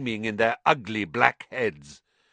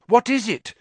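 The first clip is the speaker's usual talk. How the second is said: high, pressing question; urgent; 13 semitones higher, 8 dB louder than usual. A narrator's reading. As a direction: demand it urgently. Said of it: "said Harry urgently".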